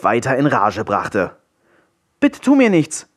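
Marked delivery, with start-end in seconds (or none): 2.2-3.1 put-on high, pleading boy's voice; a scared child begging the wolf; frightened boy calling out. A narrator's reading. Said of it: "rief Willi".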